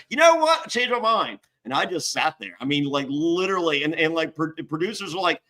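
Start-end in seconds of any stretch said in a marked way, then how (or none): 0.1-1.4 high smug gotcha voice; mock judge. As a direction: pitch it up like a gloating judge. Imitating judge reversing decision.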